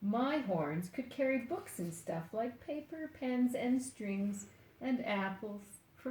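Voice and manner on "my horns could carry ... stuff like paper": ordinary delivery, nothing marked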